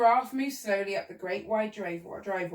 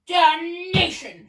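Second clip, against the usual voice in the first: high, loud, drawn-out curse; angry shout; 9 semitones higher, 11 dB louder, much slower than usual. A narrator's reading. Duke denied his cherries, angry shout. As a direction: roar it slowly in frustration. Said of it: "shouted the Duke".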